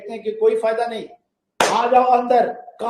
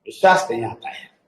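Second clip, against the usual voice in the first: low, unlike-himself voice of a skeptic; a hint of a sulky unbeliever; mock doubter; 11 semitones lower than usual. Imitating listeners who still won't believe, low and sulky. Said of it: "बोल दो, बोले".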